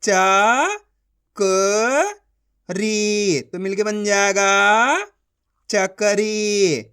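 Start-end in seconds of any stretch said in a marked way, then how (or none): 0.0-3.4 slow, each syllable of च-क-री pulled apart; teacher sounding out a word. Shown as a demonstration. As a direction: sound out चकरी as च, क, री with gaps. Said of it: "एक एक अक्षर का उच्चारण करके".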